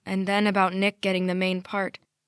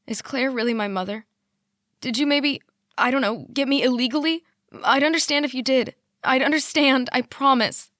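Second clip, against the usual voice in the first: higher and louder, pressing; anxious pleading; 5 semitones higher, 5 dB louder than usual. A narrator's reading. Daughter pleading with her father for the truth. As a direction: beg him to admit it.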